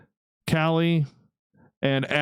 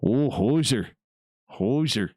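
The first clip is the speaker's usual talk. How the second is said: put-on Canadian accent. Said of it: "Very Canadian right there".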